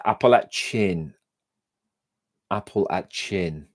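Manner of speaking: "Appalachian" sounded out as "apple-at-chin"; deliberate, syllable-by-syllable "Appalachian"; careful pronunciation. Shown as a demonstration. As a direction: slowly practise "Appalachian" with a hard "ch". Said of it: "I can never say that".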